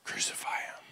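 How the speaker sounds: eerie hushed whisper; whispered crowd cry. Retold or imitated. Crowd condemning Jesus, an ominous whisper. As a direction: breathe it out, ominous.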